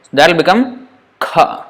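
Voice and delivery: kha with a strong puff of breath after k; aspirated kha. Shown as a demonstration. Teacher demonstrating aspiration to students. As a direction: force air out on kha. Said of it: "force some air from the throat".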